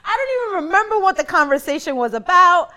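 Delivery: high, laughing retold friend; laughing quote. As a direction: laugh through the friend's words. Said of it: "You were like".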